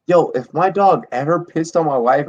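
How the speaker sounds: ordinary talk, nothing marked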